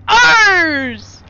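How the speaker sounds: loud, high reveal; loud high cry of ours; dramatic shout. Acted. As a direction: shout it out as a big twist.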